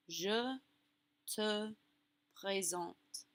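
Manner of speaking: each French word set apart by pauses; slow model, one word at a time; word-by-word demo. Shown as a demonstration. Teacher breaking up the French phrase for learners, word by word. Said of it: "you could say".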